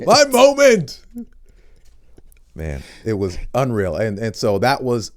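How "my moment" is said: high, loud triumphant cry; hammed-up moment of glory; starstruck ecstasy. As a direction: belt it out in overjoyed high pitch. Acts out fanboy ecstasy.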